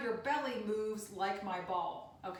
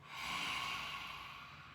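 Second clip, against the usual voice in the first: quiet lion's breath; 8 dB quieter than usual. Shown as a demonstration. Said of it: "way from the back of your throat".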